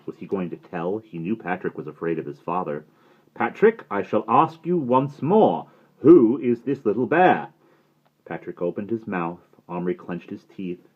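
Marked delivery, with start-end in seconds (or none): 3.3-7.5 slow stern headmaster. A narrator's reading. Headmaster asking once more.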